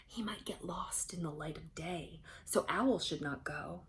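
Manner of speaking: hushed unvoiced whisper, then back to voice. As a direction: whisper it like a secret among animals. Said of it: "the animals whispered".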